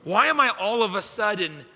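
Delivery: acted-out outburst, baffled at yourself; blowing up. Husband questioning his anger at a puppy, exasperated outburst. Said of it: "And I'm blowing up".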